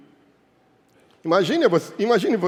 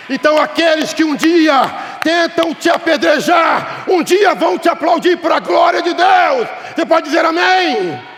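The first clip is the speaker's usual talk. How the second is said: loud, high shouted promise with stretched endings; full-force preaching; 10 semitones higher, 7 dB louder than usual. Real, not acted. Preacher promising victory and calling for amens, full-force preaching. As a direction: build to a rousing climax.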